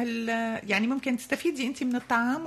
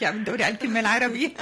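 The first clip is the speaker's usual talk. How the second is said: laughing; 6 dB louder, faster than usual. Real, not acted. Laughing at a loanword.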